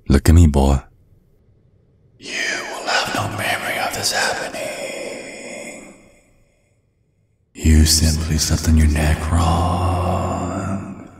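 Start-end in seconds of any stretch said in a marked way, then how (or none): 2.2-11.1 slow, soft whispered commands; hypnotic whisper. Acted. Dad wiping the date's memory, slow hypnotic whisper. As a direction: plant it in his mind like a hypnotist.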